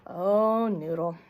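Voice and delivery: plain reading voice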